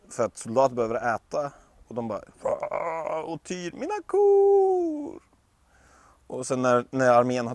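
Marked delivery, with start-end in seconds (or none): 2.4-5.2 high, slow lament over the cows; wailing farmer. Acted. Frej lamenting as soldiers slaughter his cows, high and slow. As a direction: cry out slowly over the lost cows.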